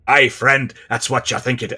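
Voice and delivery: loud opening, botched Irish or Scottish brogue; terrible Irish-Scottish accent. Acted. Old hare hails a friend in a terrible Irish-Scottish accent. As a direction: attempt a Celtic accent badly. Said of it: "that is a terrible accent".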